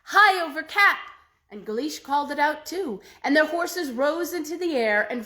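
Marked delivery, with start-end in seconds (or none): 0.1-1.0 loud ringing call, pitched up high; a bold magic cry, loud and high. A narrator's reading. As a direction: sing it out across the sea to lift the horses. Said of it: "they called out".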